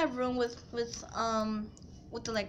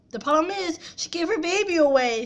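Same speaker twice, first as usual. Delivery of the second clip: louder, faster, higher complaint; mock loud stepmom; 5 semitones higher, 6 dB louder, faster than usual. Stepmom complaining to the cop, loud and quick. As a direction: spit it out to the cop. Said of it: "she said".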